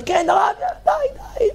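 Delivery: yelled high, fed up; high shout. Retold or imitated. Student on the breakwater yelling back to the rabbi.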